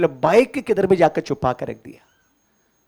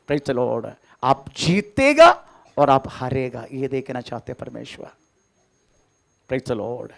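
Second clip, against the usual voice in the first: ordinary delivery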